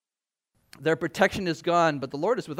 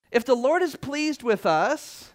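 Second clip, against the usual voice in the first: "If the Lord is pleased with us" said slowly and higher with a rise on "is"; slow emphatic; 5 semitones higher, slower than usual. Shown as a demonstration. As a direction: lean on "is", drawn out and lifted. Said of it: "repeated with emphasis".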